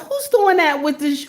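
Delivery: ordinary delivery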